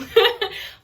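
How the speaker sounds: loud laugh breaking into the sentence; sudden real laugh; burst of laughter. Real, not acted. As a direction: laugh it off at yourself. Laughs at own rambling.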